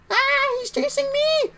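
very high-pitched, drawn-out squeal of alarm; panicked squeal. Real, not acted. Streamer fleeing an enemy in a game, panicked squeal. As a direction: squeal it as if being chased.

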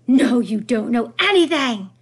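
slow, full-force yell; angry yell. A narrator's reading. Grandmother cutting off her daughter-in-law, angry yell. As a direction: cut in loudly and stretch it out. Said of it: "yelled Grandemere".

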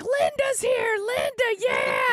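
very high, excited squeal; cartoon cat voice. Cats greeting their crush the babysitter, high squealed cat voice. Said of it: "they're all like".

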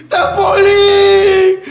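high, wailing, long-drawn yell; hammed-up drunk fan begging at full volume; mock drunken shout. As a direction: wail the request like a sozzled uncle. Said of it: "Mi tío estaba, pero zampado".

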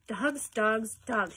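loud, fed-up "Dogs, dogs, dogs"; exasperated cry. A narrator's reading. Mother sick of hearing about dogs, loud and exasperated. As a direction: hurl the words out in disgust. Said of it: "she cried".

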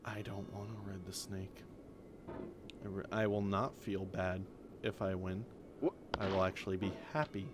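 soft, low sulky retort; muttered retort. Real, not acted. Player grumbling back at the narrator, soft and sulky. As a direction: grumble back under your breath.

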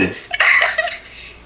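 high, excited squealing laugh; shrieking laugh. Real, not acted. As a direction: burst out in a giddy shriek of laughter. Girls shriek-laughing at seeing him.

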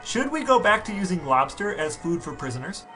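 normal talking voice

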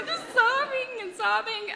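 high, slow, loud words through laughter; laughing hard. Real, not acted. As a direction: tell it while cracking up. Tells sobbing story while laughing.

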